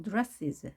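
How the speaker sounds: "dresses" with a light, weak "is" ending. Shown as a demonstration. Teacher models "dresses" for learners, weak ending. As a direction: let the final "is" of "dresses" fall away weakly. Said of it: "is 발음을 약하게".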